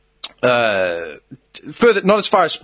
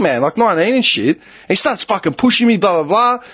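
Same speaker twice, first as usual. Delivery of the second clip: ordinary talk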